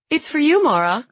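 put-on high, loud kid-brother announcement; gleeful teasing. A narrator's reading. Little brother hands over the phone, gleefully teasing. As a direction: call it out to needle a sister.

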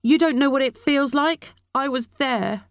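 put-on high-pitched teenage outburst; high, hurt angry protest; shouting. A narrator's reading. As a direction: hurl it back at her, pitched high. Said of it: "and then shouted".

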